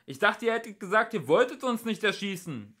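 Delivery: high, loud indignant call; shouted protest. A narrator's reading. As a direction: shout a complaint over to them. Calling out to armed police.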